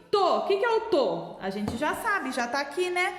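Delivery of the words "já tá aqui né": ordinary talk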